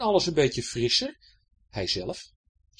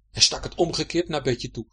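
plain reading voice

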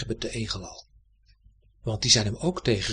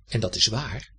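ordinary delivery

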